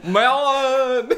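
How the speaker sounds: very high, loud, laughing squeal; mock exclamation. Podcast host replaying the word melon for laughs, mock squeal. Mocking how the word was said.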